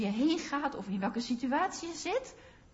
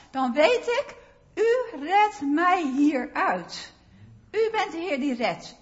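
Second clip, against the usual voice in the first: fervent preaching; 3 semitones higher, 5 dB louder than usual. Acted. Preacher testifying to the congregation, fervent and louder. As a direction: proclaim each rescue with full conviction.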